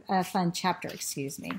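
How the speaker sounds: usual speaking voice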